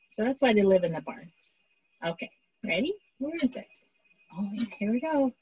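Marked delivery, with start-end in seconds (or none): none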